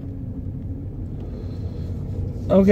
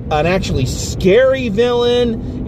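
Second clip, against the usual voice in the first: usual speaking voice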